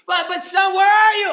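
high shouted call after a runaway; God calling out. Acted. God searches for his hiding son, shouting out. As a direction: holler for a lost son. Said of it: "God chases after you and says".

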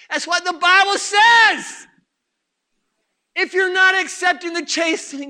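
full-force preaching. Real, not acted. Preacher exhorts the congregation, full-force preaching. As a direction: preach it hard to the back row.

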